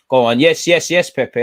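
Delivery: loud, high burst of hope; yelling at the screen as Pepe breaks; excited cheer. Real, not acted. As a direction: roar him on as the chance builds. Cheering a near chance.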